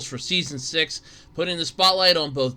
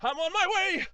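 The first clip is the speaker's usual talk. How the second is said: high, strained climber's voice; climbing stairs; 5 dB quieter than usual. Pretend. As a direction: puff your way up the steps. Trudging up the stairs.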